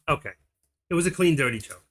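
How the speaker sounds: ordinary talk, nothing marked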